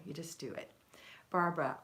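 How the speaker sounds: normal talking voice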